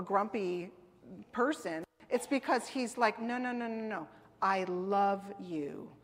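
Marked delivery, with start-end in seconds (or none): 3.2-5.9 tender reassurance. Acted. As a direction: gently reassure like a loving father. God voiced as loving, not grumpy.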